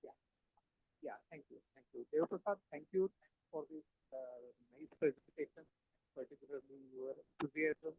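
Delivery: faint voice on a bad connection; very quiet, hard to hear; barely audible. Real, not acted. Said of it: "Your voice is very low and breaking as well".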